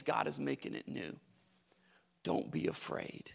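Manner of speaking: deep faint whisper; almost no voice, intimate. Acted. Hush at close.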